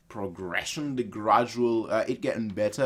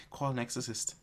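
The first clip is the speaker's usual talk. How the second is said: soft, playfully spooked plea; under your breath, mock-scared; hushed mock plea; 8 dB quieter than usual. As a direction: quietly beg for help, half-joking. First, hushed exorcist plea.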